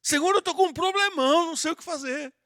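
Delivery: a mock worried cry to God; worried prayer. Shown as a demonstration. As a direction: sound troubled, asking for help. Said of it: "Se você tiver preocupado, fala".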